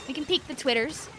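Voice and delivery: normal talking voice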